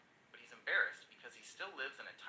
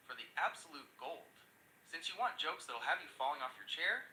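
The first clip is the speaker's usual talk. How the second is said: ordinary delivery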